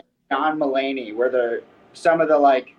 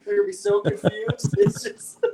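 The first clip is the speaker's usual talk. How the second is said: laughing. Real, not acted. Giggling at their own prank.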